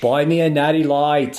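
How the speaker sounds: playful put-on Boston accent. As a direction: do a jokey Massachusetts accent. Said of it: "because this is Boston".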